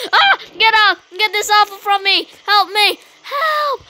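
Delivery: mock panic building to a high yell; panicked cries for help. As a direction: play a toy being grabbed, yelling help. Character attacked, cries for help.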